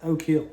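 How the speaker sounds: "Oak Hill" as one word, "O-kill"; run together. Shown as a demonstration. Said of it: "it always just ran together".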